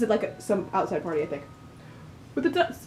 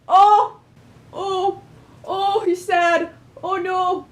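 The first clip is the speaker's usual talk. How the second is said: high, loud heartbroken cries; distressed exclamations; 8 semitones higher, 6 dB louder, much slower than usual. Real, not acted. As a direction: cry out in dismay. Player distraught for character.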